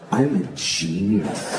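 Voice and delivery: slow, low, self-adoring boast; Matt Damon. Acted. Actor writing himself a genius role, smug. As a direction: savour it slowly, down low. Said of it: "he's like".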